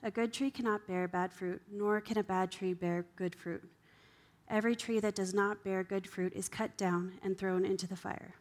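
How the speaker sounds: ordinary talk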